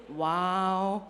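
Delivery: low, soft, drawn-out wow; loving wow. Shown as a demonstration. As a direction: breathe out a tender, admiring wow. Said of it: "love them for who they are and say".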